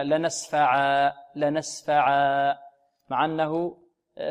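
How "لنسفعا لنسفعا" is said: "لنسفعا" said very slowly, ending on a long alif; pausal reading. Shown as a demonstration. Teacher shows students how to pause on "لنسفعا", very slow. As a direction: stretch "لنسفعا" and stop on the alif. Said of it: "نقف عليها هكذا".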